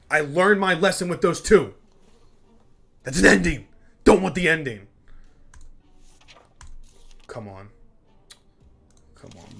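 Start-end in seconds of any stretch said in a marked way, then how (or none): 0.1-4.9 louder, higher insistent refusal; loud protest. Real, not acted. Player refuses the game's ending option, loud insistent protest. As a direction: push back hard at the screen.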